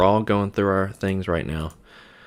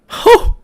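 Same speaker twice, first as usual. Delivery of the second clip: high surprised yelp; 9 dB louder than usual. Real, not acted. Sudden reaction to ticker move.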